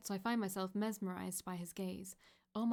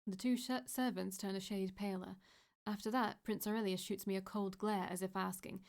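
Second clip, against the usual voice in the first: ordinary delivery, nothing marked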